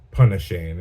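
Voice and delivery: punny Shane, first word rhyming with funny; "punny" starting like pun; intended nickname pronunciation. Shown as a demonstration. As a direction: say "punny Shane" with a short u, as in Punisher. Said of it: "it wrote and sounded like puny Shane".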